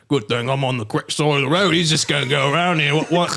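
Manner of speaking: mock British accent, rising to confusion; hammed-up Englishman, confidence turning puzzled; Benedict Cumberbatch. Running down the road toward a car, mock British. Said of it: "Your accent of Benedict Cumberbatch".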